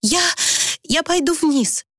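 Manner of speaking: high, halting announcement; nervous, faltering excuse; flustered escape. A narrator's reading. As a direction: stammer a start, then blurt it high. Frightened woman flees the room.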